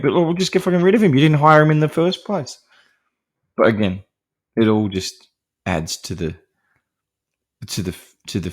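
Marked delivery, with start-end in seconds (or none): 0.1-2.6 fast, higher, mock-annoyed outburst. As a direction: rattle it off like an irritated hotel owner. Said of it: "she's annoyed".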